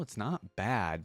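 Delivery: ordinary talk, nothing marked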